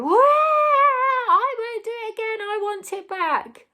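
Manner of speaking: mock child begging to get the toy back; whiny child. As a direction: plead like a sulky toddler. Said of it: "They can go".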